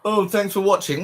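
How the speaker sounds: sarcastic high-pitched imitation; mocking the TV host. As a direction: parody the presenter's prim thanks. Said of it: "talk to me like that".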